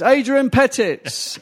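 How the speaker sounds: high, slow formal announcement; toastmaster announcing. Retold or imitated. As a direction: call the name out to the whole room. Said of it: "he says".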